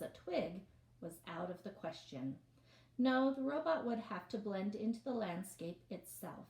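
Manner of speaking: lively read-aloud storytelling voice; story narration. A narrator's reading. Reads novel aloud to class.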